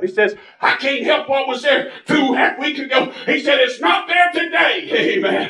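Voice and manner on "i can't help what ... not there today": higher-pitched retold outburst; imitating a worked-up protest; fired up. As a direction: mimic a fiery doctor insisting, climbing to a shout. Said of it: "El Casino gets fired up".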